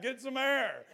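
building from urging to high, loud whooping shouts; exuberant shouting. Real, not acted. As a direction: whip the crowd up until you're yelling. Pastor urging congregation to jump.

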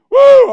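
loud, high "woo"; exultant whoop. Real, not acted. Preacher carried away by living water, loud whoop.

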